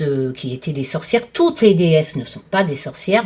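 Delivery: ordinary talk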